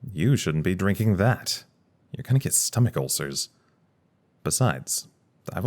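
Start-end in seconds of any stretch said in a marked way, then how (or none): 0.0-1.6 drawn-out, louder stress on "You". Acted. Turning a coworker's drink warning back on them, YOU stressed. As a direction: throw it back slowly, leaning hard on "You". Retorts by echoing the other's warning.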